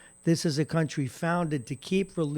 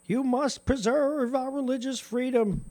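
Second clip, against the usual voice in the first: mocking quote; 9 semitones higher than usual. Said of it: "a religious group saying".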